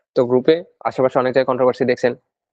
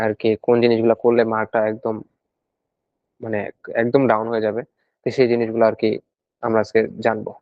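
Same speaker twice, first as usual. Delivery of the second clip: normal talking voice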